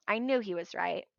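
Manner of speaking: usual speaking voice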